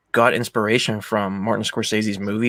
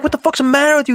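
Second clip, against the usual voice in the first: loud, high retold outburst; mock fury, pitched way up; imitated angry challenge; 14 semitones higher, 6 dB louder, faster than usual. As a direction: act out an indignant confrontation. Acting out angry quarrel.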